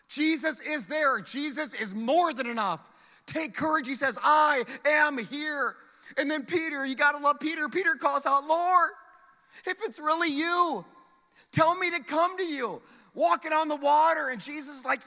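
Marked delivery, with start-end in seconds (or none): 4.2-5.8 slow and loud, each word spaced; bold proclamation. Acted. Jesus' words proclaimed again. 8.5-14.3 higher and louder eager call; Peter calling out. Acted. Peter calls out to Jesus.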